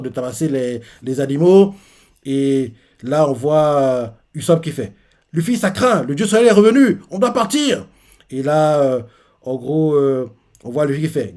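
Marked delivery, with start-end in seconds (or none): 5.3-7.9 panicked warning. Retold or imitated. Usopp warns Luffy.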